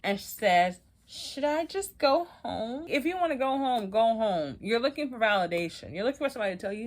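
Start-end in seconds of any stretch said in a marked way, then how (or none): 1.1-2.9 high, slow and whiny, a mock-tearful plea; mock crying. Keely crying outside to the housemate, a mock whine. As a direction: imitate the fake tears with a drawn-out whimper. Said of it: "And Keely starts crying".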